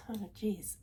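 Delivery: breathless whispered oh, geez; whispered aside. Real, not acted. Annoyed at her own slip, whispering to herself. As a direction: mutter to yourself under your breath. Flustered aside mid-sentence.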